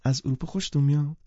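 light up at the mention of Europe; surprised and pleased. A narrator's reading. Catherine, pleasantly surprised, asking the narrator about Europe. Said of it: "با تعجب و خوشحالی گفت".

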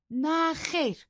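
put-on high young woman's voice, hurt; young wife, aggrieved. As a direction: plead that nobody knows her pain. Isabella voiced in the story.

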